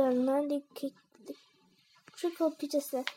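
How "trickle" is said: usual speaking voice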